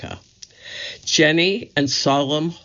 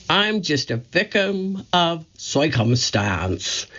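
put-on slow Brooklyn accent, a little higher; Curly's squeaky voice; 3 semitones higher, slower than usual. Curly making excuses, slow Brooklyn accent. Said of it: "he said when he wasn't".

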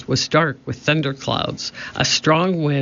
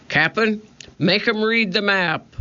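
high pirate voice; 7 semitones higher than usual. A narrator's reading. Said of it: "said Stinky".

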